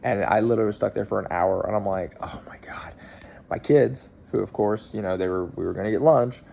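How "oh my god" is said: breathy unvoiced "oh my God" under the breath; exasperated whisper. Dad stuck an hour on ninety-five, whispering in exasperation. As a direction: whisper it like you're giving up on the traffic.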